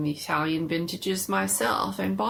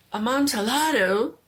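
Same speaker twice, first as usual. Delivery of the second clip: louder, higher, hungry for the wine; eager exclamation; 6 semitones higher, 6 dB louder than usual. Acted. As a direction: blurt the word in excitement. Fortunato repeats the wine name.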